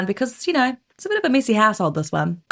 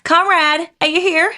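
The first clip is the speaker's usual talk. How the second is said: put-on high, raised American-accented call; 6 semitones higher, 4 dB louder than usual. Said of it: "do that in an American accent".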